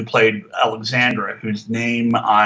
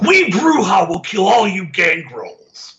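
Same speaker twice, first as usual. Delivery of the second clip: slow, loud, higher hammed-up declaration with a shout; mock announcement; 6 semitones higher, 4 dB louder than usual. Mocking cheesy TV vampires who announce their clans: loud, slow, hammed-up. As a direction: boom it out like a cheesy TV villain proclaiming his clan. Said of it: "constantly announcing themselves as".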